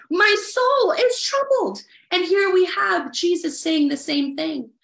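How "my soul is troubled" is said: higher, lifted repeat of the verse; the verse echoed in a higher voice; raised echo. Retold or imitated. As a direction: lift it up as David's cry. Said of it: "the same words that David said".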